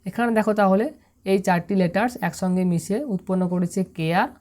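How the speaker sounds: ordinary talk, nothing marked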